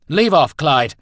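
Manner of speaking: loud, high angry order; angry growl. A narrator's reading. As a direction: growl it loudly to shake someone off. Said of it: "Roland growled".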